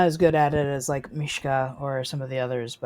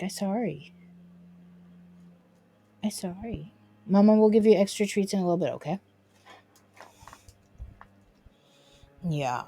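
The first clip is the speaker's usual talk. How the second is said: higher sing-song pet talk; 5 semitones higher, 7 dB louder than usual. Real, not acted. Owner doting on her dog at her desk. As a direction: dote on the dog playfully.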